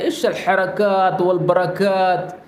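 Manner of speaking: slow, deliberate Arabic phrase; careful teaching pace; slow example phrase. Shown as a demonstration. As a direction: say it slowly so students catch it. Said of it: "आप उसको कहते हैं".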